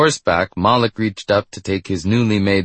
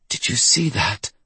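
breathless, awed gasp of a question; 3 semitones higher than usual. A narrator's reading. Doctor gripped by terror or wonder, gasping. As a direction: gasp it out in disbelief. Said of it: "Doctor Avanthikar gasped".

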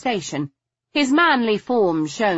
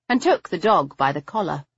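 ordinary delivery, nothing marked

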